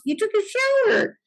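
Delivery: hammed-up surprise from the wife; mock incredulous. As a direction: squeak it out in disbelief. Quoting Aida's disbelief.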